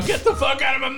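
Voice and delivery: high-pitched mock shout; mock freak-out shout. Friend mimicking the man on acid, mock freak-out shout. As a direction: yell it like a hammed-up freak-out. Listener mocks storyteller's outburst.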